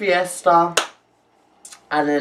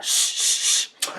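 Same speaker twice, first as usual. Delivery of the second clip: shushing; 6 dB quieter, slower than usual. Retold or imitated. People shushing him.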